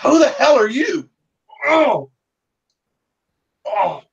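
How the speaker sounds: high, strained wounded voice; pained marshal. Acted. Wounded marshal asking Russet for a doctor, high and strained. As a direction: whine it through the pain. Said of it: "he goes".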